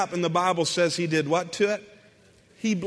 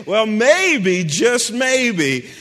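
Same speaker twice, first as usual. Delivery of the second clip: ordinary delivery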